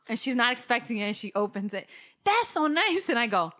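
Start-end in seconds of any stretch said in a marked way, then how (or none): 2.2-3.1 high, loud pleased exclamation; hammed-up delight; imitating mom. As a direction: gush like a touched mom. Mom opening her gift, acted.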